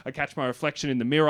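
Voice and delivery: usual speaking voice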